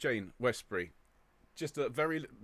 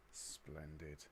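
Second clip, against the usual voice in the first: soft aside; 8 semitones lower, 16 dB quieter than usual. Real, not acted. Soft aside between greetings.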